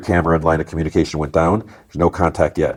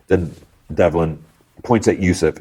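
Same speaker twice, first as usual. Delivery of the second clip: usual speaking voice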